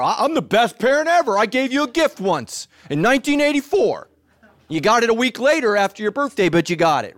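high, loud, self-satisfied boasting; smug bad parent. Bad parent bragging to their child, pleased with themselves. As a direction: crow about a late birthday gift. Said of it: "Bad parents think they're".

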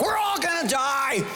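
laughing mock panic shout; hammed-up doom shout. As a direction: pretend to panic in a staff meeting. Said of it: "I'm like".

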